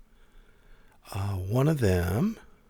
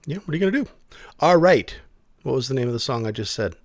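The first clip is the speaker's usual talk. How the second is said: usual speaking voice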